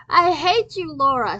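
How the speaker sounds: loud, high furious yell. Acted. Kristen yells at Laura in a strange voice. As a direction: scream it at her, voice cracking. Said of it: "she yelled in a voice".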